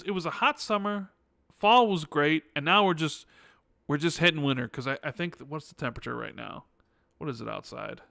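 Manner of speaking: normal talking voice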